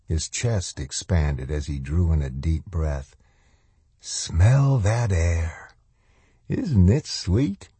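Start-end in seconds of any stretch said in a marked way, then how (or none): 4.0-7.7 very slow, drawn-out relish; savouring sinister man. A narrator's reading. Stranger savouring the air before a survivor, slow relish. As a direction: stretch the words out, savouring each.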